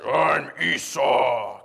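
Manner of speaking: slow, low put-on fake voice; Esau. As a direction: badly fake a big brother's voice, low and drawn out. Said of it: "Jacob put on a fake voice".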